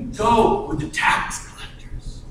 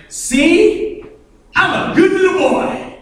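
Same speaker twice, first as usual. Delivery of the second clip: put-on much higher, louder, childish boast; mock good boy; 12 semitones higher, 5 dB louder, slower than usual. Pharisee holding out his resume to God, childish boasting. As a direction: simper like a proud little boy.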